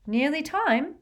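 high, carefree lilt; breezy and untroubled; blithe. A narrator's reading. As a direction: toss it off airily. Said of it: "said Harry blithely".

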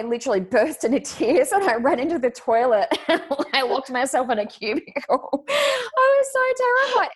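high, squealing laughter through the words; laughing while telling. Real, not acted. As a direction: barely get the story out for laughing. Laughs recalling her tearful flight.

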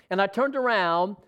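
ordinary talk, nothing marked

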